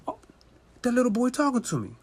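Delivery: normal talking voice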